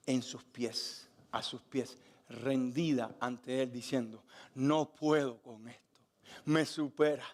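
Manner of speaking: quiet, reverent storytelling from the pulpit; hushed preaching. Acted. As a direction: linger tenderly on each word. Hushed retelling at Jesus' feet.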